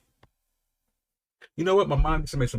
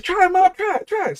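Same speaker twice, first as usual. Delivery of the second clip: put-on eager little boy; 4 dB louder than usual. Child urging mom.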